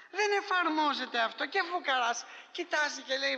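ordinary talk, nothing marked

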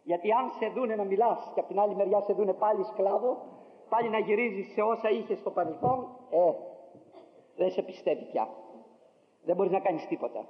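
ordinary talk, nothing marked

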